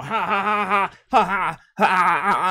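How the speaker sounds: mock laugh. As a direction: laugh at him like a cartoon villain. Mocking laugh at Chili.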